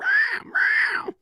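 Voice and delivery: very high double squawk; imitated high-pitched dinosaur cries; pterodactyl screech. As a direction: shriek like a pterodactyl in flight. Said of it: "It'd be doing that thing".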